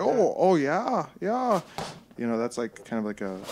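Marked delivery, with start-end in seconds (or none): 0.0-1.6 higher, lilting Minnesota accent; put-on Minnesota accent, higher voice. Shown as a demonstration. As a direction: agree like a mock Minnesotan, pitched up. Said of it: "a Minnesota accent".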